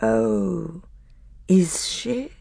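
cold, menacing sneer in a strange voice; mock the claim with dread; werewolf's grey, menacing sneer; grey, terrible voice. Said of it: "That grey and terrible voice".

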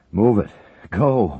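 loud urgent cry, out of breath; breathless cry. A narrator's reading. Wickham urging the crew to the chopper, loud and breathless. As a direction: push them on, gasping for air. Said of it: "Wickham cried breathlessly".

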